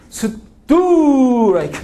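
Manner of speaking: drawn-out loud high strike call, every syllable held; Korean umpire call. Retold or imitated. Teacher mimics a Korean baseball umpire, hammed-up and laughing. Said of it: "한국 야구 심판들은".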